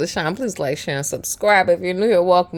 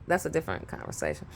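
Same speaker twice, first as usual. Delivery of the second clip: ordinary delivery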